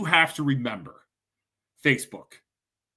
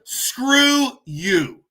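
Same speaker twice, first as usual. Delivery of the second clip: angry retort; 6 semitones higher than usual. Real, not acted. Furious retort at commenters.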